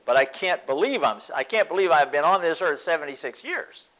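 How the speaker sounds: normal talking voice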